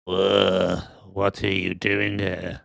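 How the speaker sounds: slow, low creepy woman voice; put-on spooky old woman. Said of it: "even creepier woman".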